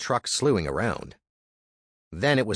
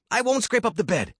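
higher, pushy younger brother; Andy's voice; 13 semitones higher than usual. Acted. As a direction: urge him on, high and eager. Andy voiced.

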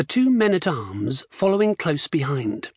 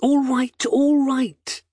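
exasperated; 8 semitones higher, slower than usual. A narrator's reading. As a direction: brush them off, irritated. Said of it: "he said".

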